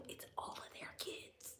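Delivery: whispered gossip. Real, not acted. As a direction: drop to a secretive whisper. Aside about neighbours' kids.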